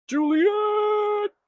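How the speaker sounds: hammed-up, very high shrieked call for Juliet; mock Romeo cry. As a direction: belt out Juliet's name like a stage lover. Mock Romeo calling back.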